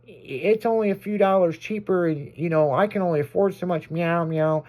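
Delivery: high, indignant woman's voice arguing with the cat. Retold or imitated.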